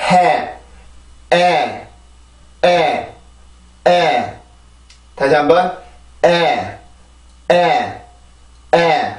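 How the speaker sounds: 에 alone as one stressed, falling beat; vowel rhythm drill. Shown as a demonstration. Teacher drilling learners on the vowel first, stressed falling 에. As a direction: ride the stress down on a lone vowel 에. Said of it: "모음으로 리듬을 먼저 만들어주죠".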